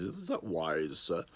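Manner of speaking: light impression of a mild doubting sergeant; Sergeant Wilson. Gently questioning the boss as the sergeant, lightly voiced. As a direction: gently query it, lightly in character. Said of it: "he's a little bit like Sergeant Wilson".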